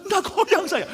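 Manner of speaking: loud, high indignant denial; hammed-up protest of innocence; retold protest. Retold argument with the barber.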